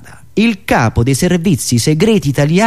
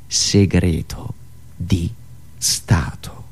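dropping low and softer into a whisper; hushed and ominous; 13 semitones lower, 7 dB quieter, much slower than usual. Acted. Comedian naming a state secret, sinking to a hushed whisper. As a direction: sink it down like a dark secret.

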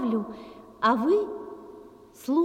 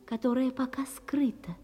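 secretive whisper; 2 semitones lower, 6 dB quieter than usual. Acted. Secret she must keep hidden.

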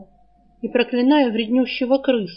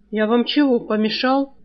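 ordinary delivery

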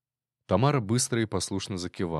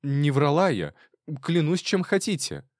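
higher, louder desperate pleading; rattling nervously; 7 semitones higher, 3 dB louder than usual. A narrator's reading. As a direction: swear it fast, near panic. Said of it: "затараторила Тамара".

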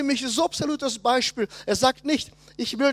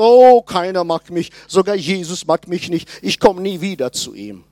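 mock offended; 3 semitones lower, 6 dB louder than usual. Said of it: "beleidigt, missverstanden und sagt".

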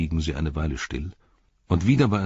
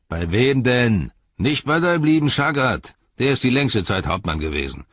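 higher-pitched orc taunt; jeering orc tracker; 8 semitones higher, 7 dB louder than usual. Acted. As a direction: jeer back at the soldier.

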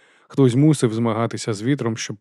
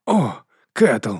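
yelp of pain; 4 dB louder than usual. A narrator's reading. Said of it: "скрикнув я".

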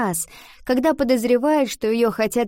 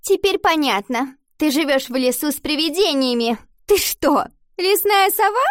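high, loud schoolgirl jeer; mocking; 7 semitones higher, 4 dB louder than usual. A narrator's reading. Classmate teases Ива about the forest, mocking and high. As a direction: taunt her, pitched up and louder.

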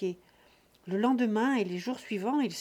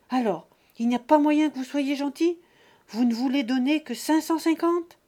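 louder, higher exasperated father; angry plea; 6 semitones higher, 8 dB louder than usual. Acted. Father haggling with sons, loud and exasperated. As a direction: demand it indignantly.

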